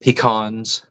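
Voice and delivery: Pecans said pee-KAHNS; Pecans as pee-KAHNZ; pee-KAHNS pronunciation. Shown as a demonstration. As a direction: say Pecans with long ee and open ah. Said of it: "however it is".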